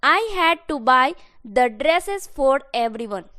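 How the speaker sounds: very slow, every word spelled out; teacher dictating the English sentence word by word; slow dictation. Shown as a demonstration. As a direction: read it out slowly for learners to copy. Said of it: "मुझे सबके लिए कपड़ा खरीदना पड़ा।".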